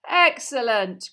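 loud, drawn-out praise; slow, ringing teacher's cheer; cried out. A narrator's reading. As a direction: exclaim it slowly with delight. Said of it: "cried Professor Lupin".

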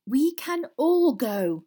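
slow, high, loud hint with "all go" drawn out; pointed. A narrator's reading. Hermione hints to Ron to leave, "all go" said pointedly. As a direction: spell out "all go" slowly as a hint. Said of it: "said Hermione pointedly".

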